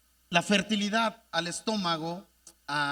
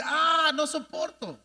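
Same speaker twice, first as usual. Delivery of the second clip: slow, higher shout of pain; retold shout; 6 semitones higher, slower than usual. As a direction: cry out in agony.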